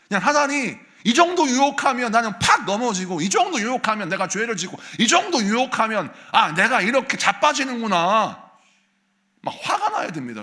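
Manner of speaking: loud, high, driving sermon climax; full-force pulpit outburst; fiery preaching. Acted. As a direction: preach it hard and rising.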